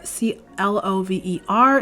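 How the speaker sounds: spelling C L O V E R letter by letter; spelling the craft brand name for viewers, letter by letter; spelled out. Shown as a demonstration. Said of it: "The brand is Clover".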